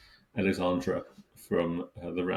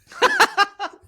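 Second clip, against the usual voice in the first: loud laugh; 9 dB louder than usual. Real, not acted. Burst of laughter at tramp.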